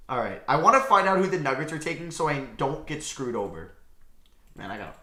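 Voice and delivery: ordinary talk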